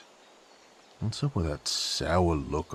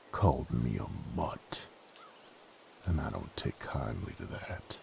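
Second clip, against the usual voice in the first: ordinary talk, nothing marked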